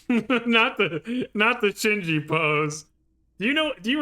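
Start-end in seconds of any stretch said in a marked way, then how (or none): 0.0-2.9 higher, louder, cracking up; real laughter breaking into speech; laughing. Real, not acted. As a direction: laugh through the words in disbelief. Cracks up recognizing the pose.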